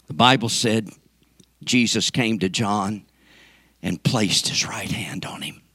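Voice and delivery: low, soft, near whisper; hushed reverence. Real, not acted. Preacher telling how Jesus touched John, hushed reverence. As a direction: let the voice sink down into a hush.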